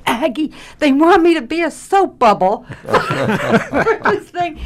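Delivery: high, loud, laughing mock protest; slow, high-pitched playful complaint; mock dismay. Retells her plea to older actress.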